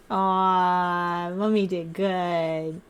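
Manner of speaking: low, slow pet voice answering; low slow pet-voice reply; voicing the pet.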